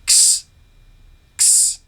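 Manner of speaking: "x" as a bare ks, no vowel; voiceless phonics "x", just ks; isolated ks sounds. Shown as a demonstration. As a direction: say "x" as k and s run together. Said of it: "the second and third sounds are".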